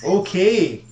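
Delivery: hammed-up Canadian accent; bad Canadian accent. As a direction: play up a stock Canadian voice. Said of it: "a very bad Canadian accent".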